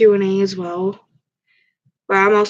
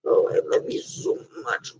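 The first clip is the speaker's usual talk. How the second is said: breathy whisper of love; 2 semitones higher, 6 dB quieter than usual. Real, not acted. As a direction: whisper it tenderly to the pet.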